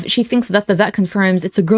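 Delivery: ordinary talk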